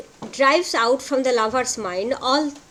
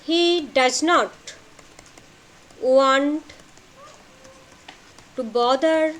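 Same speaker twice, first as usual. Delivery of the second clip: very slow, word groups set far apart; halting note-taking delivery; dictation. Shown as a demonstration. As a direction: dictate it in tiny chunks with long pauses. Continues dictating notes to students.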